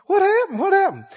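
high, eager repeated question; acted high-pitched astonishment; amazed questioner. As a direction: press for the story in amazement. Questioner presses for the story.

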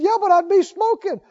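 high, insistent mock objection; imitated protest. Smoker objecting to the preacher's advice, insistent protest. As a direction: push back like a baffled smoker.

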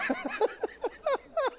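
high-pitched wheezy burst of laughter; real helpless high laugh. Real, not acted. Preacher tickled by his own phrase, high laughing burst. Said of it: "I'm laughing 'cause some people know".

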